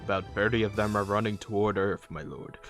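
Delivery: loud, brisk report to a dark lord; urgent minion's update; villain's henchman. Acted. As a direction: report quickly and loudly to your master.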